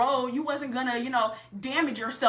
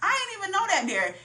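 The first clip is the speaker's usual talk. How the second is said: mock surprise, pitched way up; caught off guard; 11 semitones higher than usual. Driver on a bumpy dirt road, mock surprised. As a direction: squeal like a pothole just caught you. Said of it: "You're like".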